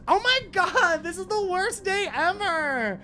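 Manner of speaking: high, loud, slowing melodramatic wail; despairing wail. Acted. Game master plays Clarissa after the blast, despairing wail. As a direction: wail it out, dragging the ending.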